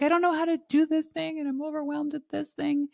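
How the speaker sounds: high, fast mock overwhelmed voice. Coach imitating an overwhelmed coaching client, high and fast. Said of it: "They're like".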